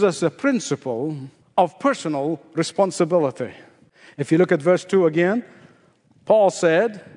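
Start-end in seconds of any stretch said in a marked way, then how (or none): none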